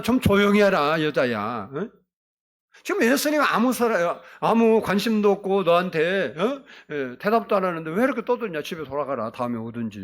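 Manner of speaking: disciples telling off a noisy woman; acted scolding. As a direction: scold her as an annoyed bystander. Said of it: "좀 조용히 하라고 막 그랬잖아요. 꾸짖어".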